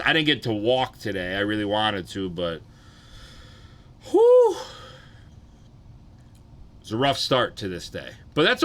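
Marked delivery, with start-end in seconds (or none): 4.1-4.6 loud, high exhale of pain; sharp high whew. Real, not acted. Streamer groaning about sore nuts, loud high whew.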